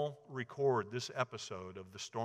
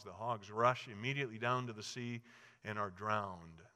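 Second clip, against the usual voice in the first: ordinary delivery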